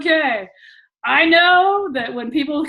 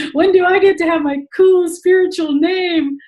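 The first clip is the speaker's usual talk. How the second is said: higher, louder mock whine of a hopeful student; whiny mock plea; 8 semitones higher, 6 dB louder than usual. Mocking students who want a cool name, whiny plea. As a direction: beg like an eager novice. Mocking what everybody wants.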